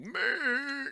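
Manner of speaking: long, high wordless bleat; yelling rock giant. Acted. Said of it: "like, yelling at each other".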